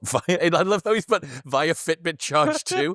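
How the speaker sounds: amused, high-pitched laughing talk. Real, not acted. Reading an oddly specific post aloud to a friend, laughing. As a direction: talk through real laughter, pitch lifted.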